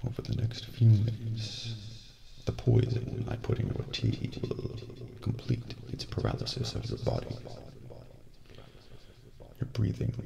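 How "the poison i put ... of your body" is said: usual speaking voice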